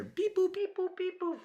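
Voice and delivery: very high, chirpy mechanical beep-boops; robot beeps. Pretend. Mocking a TSA screening machine with high robotic beeps.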